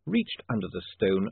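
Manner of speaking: ordinary delivery, nothing marked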